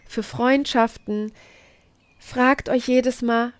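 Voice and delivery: usual speaking voice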